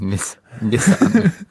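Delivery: slow, drawn-out "Nissan" with a stressed first syllable; a teasing copy of how she says "Nissan"; imitating her Nissan. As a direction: say "Nis-, Nissan" slowly, mocking her pronunciation. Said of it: "Is that what you think I sound like?".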